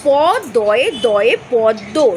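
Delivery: slow, deliberate letter-by-letter spelling of প দয়ে দয়ে পদ্ম; teacher slowly spelling out পদ্ম letter by letter. Shown as a demonstration. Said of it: "সেটা হয়ে যাচ্ছে".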